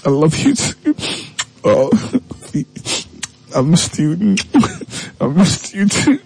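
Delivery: mock tearful girlfriend. Reconciling couple, woman's voice.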